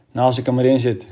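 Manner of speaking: ordinary delivery, nothing marked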